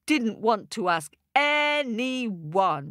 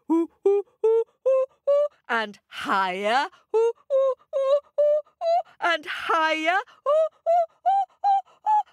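hoots rising higher and louder; climbing monkey hoots; 4 dB louder than usual. A narrator's reading. Monkey climbing the tallest tree, hooting ever higher. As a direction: climb the pitch with each hoot.

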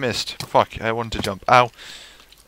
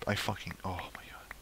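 exasperated whisper; 13 dB quieter than usual. Real, not acted. Frustrated at game mob.

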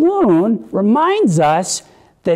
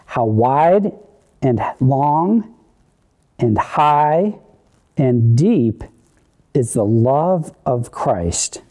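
each word set apart by pauses, lower and measured; slow call-and-response; 5 semitones lower, slower than usual. Shown as a demonstration. Leading children through a verse, slow and spaced. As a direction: read it out slowly for children to follow. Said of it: "Can you read it with me?".

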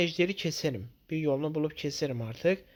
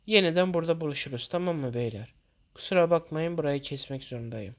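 ordinary talk

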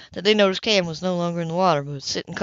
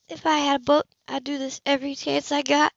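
lightly voiced woman, dreamy and a bit higher; a hint of a wistful woman on the boat; Anna's voice; 6 semitones higher than usual. A narrator's reading. Anna voiced in dialogue.

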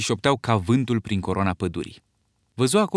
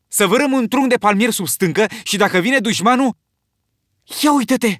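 high, loud, eager plan; thrilled shout about the rock; excited outburst; 10 semitones higher, 6 dB louder than usual. A narrator's reading. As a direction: burst out with boyish glee. Jack bursts out excited.

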